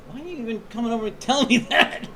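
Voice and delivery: high-pitched mock disbelief, laughing through it; laughing, incredulous mock question; incredulous reaction. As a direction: act baffled in a high voice, breaking into a laugh. Acting out a baffled reaction.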